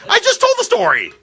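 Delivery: indignant high protest. Real, not acted. Host refuses guest retelling.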